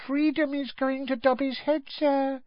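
high, mournful little squeak; sad squeaky elf. A narrator's reading. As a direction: lament in a tiny elf voice. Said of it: "said Winky sadly".

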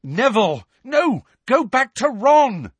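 high, loud alarmed cry; urgent shout. A narrator's reading. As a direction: stop him, shout it out. Harry shouting at Neville.

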